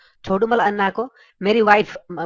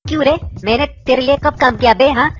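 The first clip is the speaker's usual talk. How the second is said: shrill angry wife; faster than usual. Acted. Wife yells at her husband over the late-night call, angry. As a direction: scold him like a furious wife woken at night. Wife scolds neighbour on phone.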